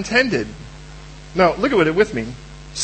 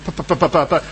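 mock beatbox of clipped pa sounds; 4 semitones lower, faster than usual. Preacher imitating Moses beatboxing for the congregation, playful pa pa beatbox. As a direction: puff out a playful pa pa beatbox rhythm. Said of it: "The guy could beatbox".

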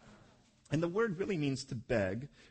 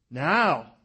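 demanding; 13 dB louder than usual. Retold or imitated. Wife demanding a baby.

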